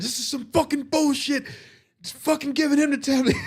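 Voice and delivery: high, whining gripe; whiny complaining voice. Retold or imitated. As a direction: whine about unfair treatment. Ryan complaining about tablet.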